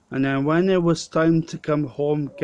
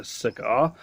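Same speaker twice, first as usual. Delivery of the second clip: normal talking voice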